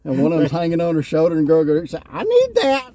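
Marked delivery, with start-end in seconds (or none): 1.9-2.9 high, drawn-out mock cry; imitated girl's demand. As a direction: stretch it out high, quoting the girl. Retold girl calling out demand.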